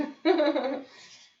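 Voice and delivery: high bursting laugh; loud laughter. Real, not acted. Laughing after quote.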